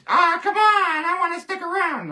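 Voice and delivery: high squeaky puppet protest; Fred pleading. Acted. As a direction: whine like a puppet that won't be put away. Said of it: "So, Fred, I'm gonna put you".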